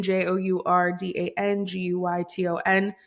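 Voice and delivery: ordinary talk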